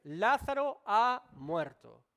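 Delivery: muy lento, fuerte y rotundo; dicho claramente. Retold or imitated. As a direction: pronuncia cada palabra despacio y con fuerza. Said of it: "les dijo claramente". (claro y tajante)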